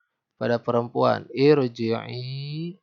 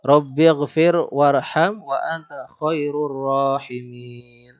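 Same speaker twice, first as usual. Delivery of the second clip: slow, louder chanted prayer verse; Quran recitation; 5 dB louder, much slower than usual. Shown as a demonstration. Teacher reciting Al-Mu'minun to students, slow chanted recitation. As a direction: intone the prayer verse slowly.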